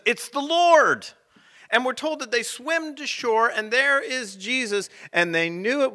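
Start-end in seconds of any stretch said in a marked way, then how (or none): 0.0-1.1 excited exclamation. Acted. John recognises Jesus, exclaims.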